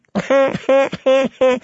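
high, mad jester's cackle; high-pitched giggling cackle. A narrator's reading. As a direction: cackle like a wicked fool. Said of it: "he cackled".

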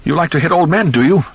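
high, loud mocking cry; bloodied old man taunts son-in-law, shrill and loud. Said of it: "cried out shrilly".

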